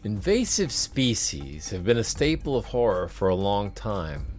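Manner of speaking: measured narrator voice over music; documentary narration. Acted. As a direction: read it like a nature documentary. Narrated podcast intro over music.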